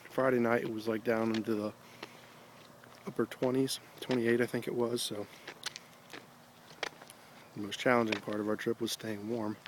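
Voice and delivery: ordinary talk, nothing marked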